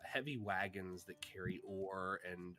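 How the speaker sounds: slow low storyteller voice; scene narration. A narrator's reading. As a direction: set the scene slowly and low. Game master sets the scene.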